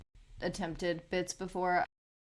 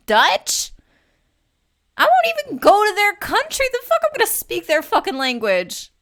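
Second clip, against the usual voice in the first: loud, much higher indignant shouting; loud high-pitched indignant rant; outraged shout; 15 dB louder than usual. Real, not acted. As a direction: blow up at the very idea. Outraged rant about Dutch.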